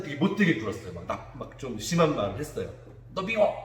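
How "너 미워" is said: mock angry kid. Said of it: "막 이렇게 막 싸웠어요".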